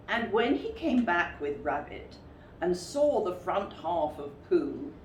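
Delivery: usual speaking voice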